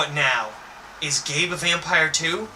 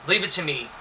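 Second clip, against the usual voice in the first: plain reading voice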